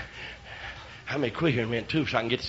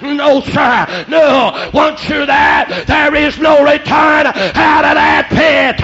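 high, loud preacher's shout; crying out; 7 semitones higher, 10 dB louder, much slower than usual. Retold or imitated. Voice warns the dreamer about hell, crying out. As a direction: cry it out as a warning from above. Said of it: "voice over the top of my head cried out".

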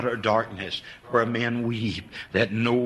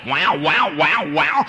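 light mock baby wail; imitating a crying baby; 4 semitones higher, 5 dB louder, slower than usual. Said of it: "when they just".

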